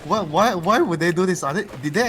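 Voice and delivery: high, loud disbelief; baffled outburst. Real, not acted. Caster reacting to an unexpected lane swap, baffled outburst. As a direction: demand an explanation, loud and incredulous.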